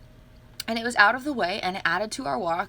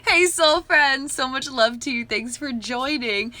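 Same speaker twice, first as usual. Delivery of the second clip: usual speaking voice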